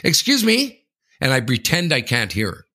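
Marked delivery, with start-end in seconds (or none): 0.0-0.8 high pointed "excuse me"; prissy much higher excuse me; mock mother. Imitating the mother demanding attention, high and pointed. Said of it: "she gives me the".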